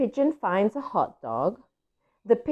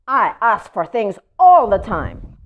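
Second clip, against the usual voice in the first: louder, whiny pigeon complaint; complaining pigeon; 3 semitones higher, 8 dB louder than usual. Acted. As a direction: grumble that it is unfair. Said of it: "here is Pigeon complaining".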